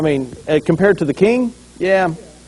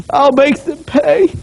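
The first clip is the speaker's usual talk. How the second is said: high, loud, slow show of mourning; public grief; 10 semitones higher, 5 dB louder, slower than usual. Acted. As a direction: play the grieving king for the crowd, slowly. Saul mourns David's body in public.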